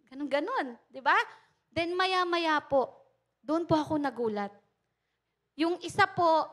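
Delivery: ordinary talk, nothing marked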